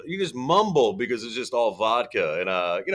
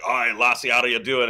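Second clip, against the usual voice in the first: Scottish accent; 3 dB louder than usual. Shown as a demonstration. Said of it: "a Scotsman be like".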